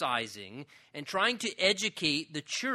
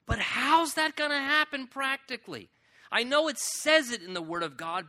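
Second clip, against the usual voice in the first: higher, puzzled voice of a doubting believer; 6 semitones higher than usual. Retold or imitated. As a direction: voice the doubter wondering aloud.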